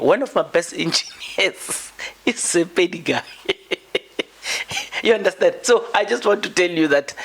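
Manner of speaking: high-pitched, laughing through the words; amused, voice lifted by real laughter; laughing while talking. Real, not acted. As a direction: crack up mid-sentence and keep explaining. Amused by own example mid-sentence.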